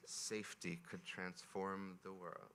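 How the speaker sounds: slow, measured reading to a crowd; reading aloud. Acted. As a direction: read it slowly, like a poem. Closing line of the reading.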